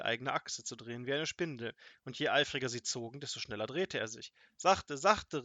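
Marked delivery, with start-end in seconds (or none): 4.6-5.4 loud, higher protest; raised-voice plea to go easy; calling out. A narrator's reading. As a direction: cry out to slow them down. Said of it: "rief er".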